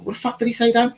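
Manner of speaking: high-pitched incredulous aside; baffled cousin. Retold or imitated. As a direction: act out a cousin who can't follow a word. Cousin baffled by fast talker.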